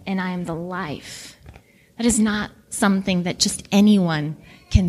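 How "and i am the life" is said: gentle, softer comforting voice; softly reassuring the weeping sisters; tender. Acted. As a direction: speak softly, as if to grieving sisters. Jesus comforting grieving sisters.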